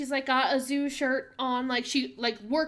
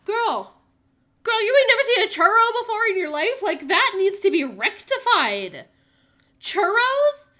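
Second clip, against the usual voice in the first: much higher, louder incredulous exclamation; outraged disbelief; 8 semitones higher, 10 dB louder than usual. Real, not acted. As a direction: shout your shock that she's never had one. Outraged disbelief at zoo worker.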